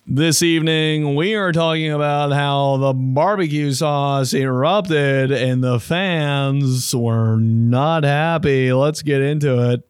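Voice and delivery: slow, measured broadcast opener; news anchor voice. Retold or imitated. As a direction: open like the evening news. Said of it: "news anchor voice".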